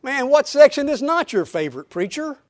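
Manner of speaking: mock a teasing churchgoer; a hint of a joking parishioner needling the preacher; teasing congregation member. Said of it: "Many of you say".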